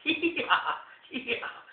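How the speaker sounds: breathy, high gleeful laugh; high mocking laugh. Potne laughing at the flooded king, gleeful.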